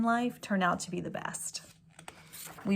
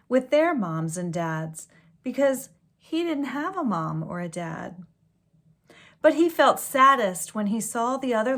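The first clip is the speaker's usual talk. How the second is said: gentle storybook read-aloud; story reading; 2 semitones higher, 6 dB louder than usual. A narrator's reading. Reading a picture book to children, storybook voice. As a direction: read it like a bedtime story to kids.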